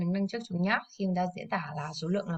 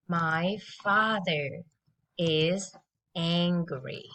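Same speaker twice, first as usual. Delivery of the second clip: slow, lower drill sentence; slow model; 3 semitones lower, slower than usual. Shown as a demonstration. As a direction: spell it out slowly for kids. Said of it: "let's listen and repeat after me".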